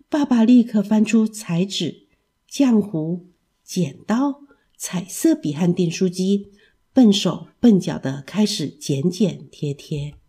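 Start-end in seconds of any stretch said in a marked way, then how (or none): none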